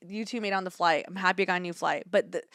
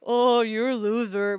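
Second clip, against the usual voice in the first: mock taunt; 4 dB louder, much slower than usual. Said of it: "Not a loser as in".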